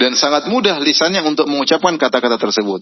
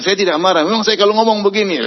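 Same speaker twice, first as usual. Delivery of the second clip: higher, protesting he always talks this way; imitating indignant denial; 7 semitones higher than usual. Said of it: "Tapi dia bilang".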